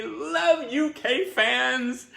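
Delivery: ordinary talk, nothing marked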